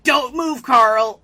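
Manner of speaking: high, loud panicked yell; mock Sheen yell. Imitating Sheen yelling at Carl, high and loud. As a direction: shout a warning in a hammed-up cartoon panic. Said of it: "Sheen's like".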